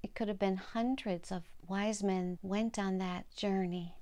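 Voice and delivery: plain reading voice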